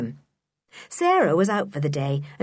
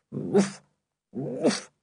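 far-off dog bark. Acted. Timmy barking from behind the walls, muffled. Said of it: "said Timmy's voice from far away".

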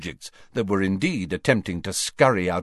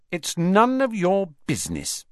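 high, indignant telling-off; angry; 10 semitones higher, 4 dB louder than usual. A narrator's reading. Ginny tells her brothers to keep out, angry. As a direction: snap back angrily, leaning on the last words. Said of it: "she added angrily to Ron".

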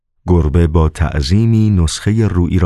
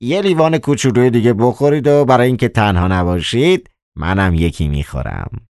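Koroviev, coaxing; 6 semitones higher than usual. Acted. Koroviev offering the master a drink, coaxing. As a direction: coax in a high character voice.

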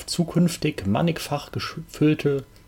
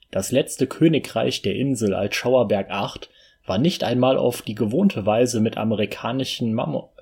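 ordinary talk, nothing marked

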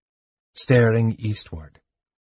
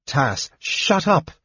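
slow, tight, biting down on pain; through clenched teeth; 4 semitones higher, slower than usual. A narrator's reading. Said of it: "Lorana said through clenched teeth".

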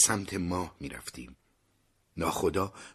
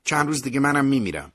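cheering up; 8 semitones higher, 6 dB louder, faster than usual. Acted. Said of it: "برای اینکه سر حالش بیارم گفتم".

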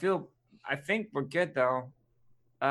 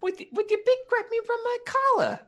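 much higher, pleading little man; high squeaky voice; 4 dB louder than usual. Acted. Asks to be flung.

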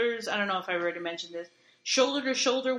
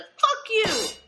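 much higher and louder parting yell; yelled curse; 14 semitones higher, 5 dB louder than usual. Retold or imitated. Patient storming out at the speaker, yelling. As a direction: spit it out on the way out.

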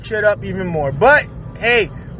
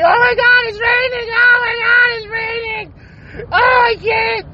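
mock panicked beachgoer; 9 semitones higher than usual. Mocking swimmers who run from the rain, hammed-up panic. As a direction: play a beachgoer fleeing rain like it burns.

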